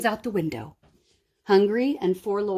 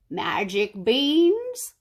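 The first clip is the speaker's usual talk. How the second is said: a slow, lightly voiced creaky old beggar; 4 dB louder, much slower than usual. A narrator's reading. Beggar tempting Kate, slow mild creak. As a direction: drag it out like a crafty old beggar. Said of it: "the beggar said in a creaky voice".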